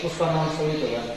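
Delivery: ordinary talk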